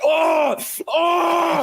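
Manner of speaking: high, loud mock screams; imitated screaming. Friend imitating Dismer screaming in the call, high loud cries. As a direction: scream out twice as if flung. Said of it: "screaming in the call".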